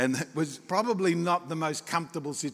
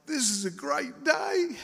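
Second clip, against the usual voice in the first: high, slow acted delight; drawn-out mock elation; blissfully excited; 10 semitones higher, slower than usual. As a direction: play a clueless, thrilled grinner. Grinning men enjoying the moment.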